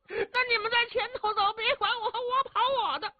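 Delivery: very high, thin, pained whine; whining complaint.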